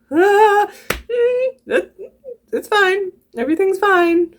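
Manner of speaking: very high, squeaky nervous giggle through the words; high flustered giggling that it's fine; flustered laugh. Real, not acted. Flustered at idea of Kurtis watching.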